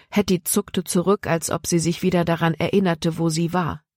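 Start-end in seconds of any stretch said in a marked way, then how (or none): none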